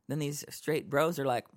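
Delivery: ordinary talk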